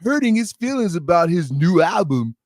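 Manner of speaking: slow nasal mocking interviewer voice; nasal voice with snot in the throat; DJ Vlad voice. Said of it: "I gotta have a nasally voice".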